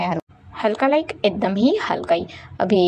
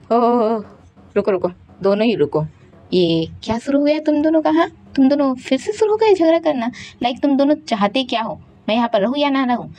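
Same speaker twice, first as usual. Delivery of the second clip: exasperated scolding; 4 semitones higher, faster than usual. Acted. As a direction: break up the fight, fed up. Juhong stops the two fighting.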